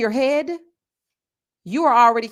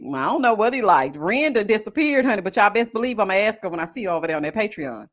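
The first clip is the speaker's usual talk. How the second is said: ordinary talk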